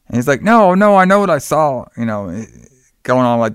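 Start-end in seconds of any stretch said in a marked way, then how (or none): 0.4-1.8 higher, louder retold protest; insistent protest. Heinrich answering Mark's doubts in a film recap, insistent protest. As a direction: push back hard, voice jumping up on the first words.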